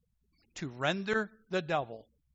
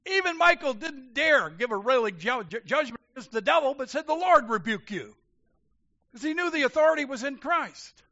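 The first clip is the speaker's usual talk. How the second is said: loud, high preaching push; fiery preaching; 9 semitones higher, 8 dB louder than usual. Acted. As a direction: drive the point home to the congregation. Preaching at full force.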